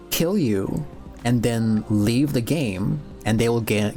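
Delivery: ordinary talk, nothing marked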